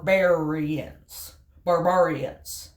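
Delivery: slow, heavy "barbarians" stretched out; drawn-out word. Shown as a demonstration. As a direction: draw out "barbarians" with a thick R. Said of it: "what the Romans thought".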